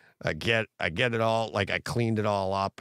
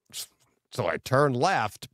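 ordinary talk, nothing marked

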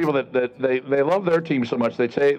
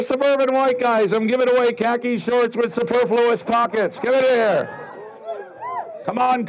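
carnival barker; 10 semitones higher than usual. Acted. Barking to suburban audience.

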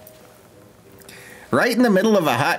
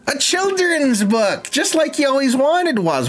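mock excitement; 10 semitones higher than usual. Streamer announcing his character's new book, mock-thrilled. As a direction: sell it like a dream come true.

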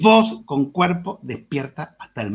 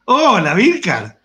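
bright hello, higher and louder; excited greeting; 5 semitones higher, 5 dB louder, slower than usual. Shown as a demonstration. Teacher showing prosody, an eager hello. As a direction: greet him with a burst of joy. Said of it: "Eso es prosodia".

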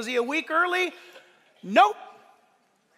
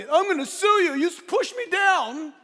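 high, loud put-on whine of grievance; mock outraged complaint; 7 semitones higher, 5 dB louder than usual. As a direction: imitate an indignant victim threatening a lawsuit. Acted ungrateful rescued person threatening suit.

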